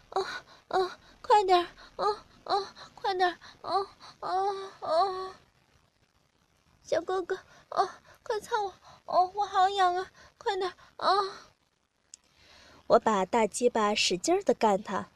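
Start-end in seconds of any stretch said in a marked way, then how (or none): 0.1-11.3 high, soft moaning voice; acted moaning. A narrator's reading. Narrator voices the partner in the story, acted moaning. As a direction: moan the words, pitched well up and softer.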